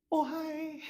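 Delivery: put-on high, soft little girl's hello; high soft girly greeting; shy girl voice. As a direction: answer sweetly like a shy girl. Shy girl answers Kyle.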